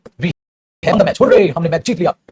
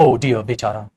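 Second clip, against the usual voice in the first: lower, pitying sigh of oh dear; sad oh dear; 7 semitones lower, much slower than usual. Shown as a demonstration. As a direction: say it with pity, lowered. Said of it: "दुख".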